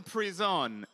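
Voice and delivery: "prison" said as pree-ZON, French-style; Frenchify "prison" with a long ee and stressed ending; French pronunciation. Retold or imitated. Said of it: "in a French accent".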